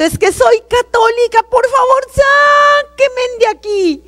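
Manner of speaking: high, slow, pleading shout; frantic high cry for rescue; desperate shout. Retold or imitated. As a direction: scream it as a last hope, dragging out sáquenme. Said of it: "desesperada grito".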